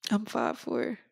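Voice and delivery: normal talking voice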